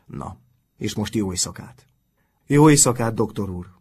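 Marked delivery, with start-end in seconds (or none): 2.5-3.7 shouted farewell. A narrator's reading. Pupils answering their teacher's goodnight, shouted in reply. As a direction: call it out with full volume. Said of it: "kiáltották".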